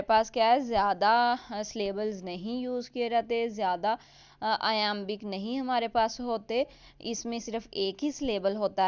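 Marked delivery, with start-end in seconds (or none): none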